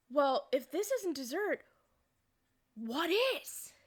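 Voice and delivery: slow setup, high rising finish; pause, then land the baffled punchline; incredulous question. Retold or imitated. Family questions the yams as dessert, slow and incredulous.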